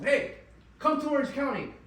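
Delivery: loud, high-pitched retold summons; urging. Friend phones Art to come down to Orange County, urging.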